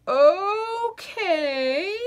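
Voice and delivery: high crying okay; tearful broken okay; sobbing. Acted. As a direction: sob the word out. Potato sobs giving up.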